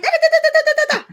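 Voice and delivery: high, loud scolding babble, laughing; mock angry outburst. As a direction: act out her own quick temper, hammed up. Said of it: "madali ako magalit".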